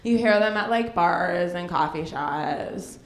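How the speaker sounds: put-on slow, low, stretched-out Hollywood girl voice; Hollywood girl drawl. Shown as a demonstration. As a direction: drag the vowels like a Hollywood girl. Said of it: "they talk like this".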